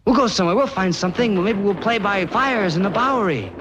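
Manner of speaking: calling out. Acted. Calling the gang to leave.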